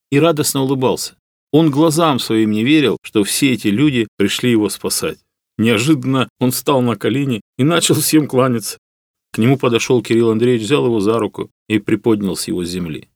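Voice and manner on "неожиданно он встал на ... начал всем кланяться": plain reading voice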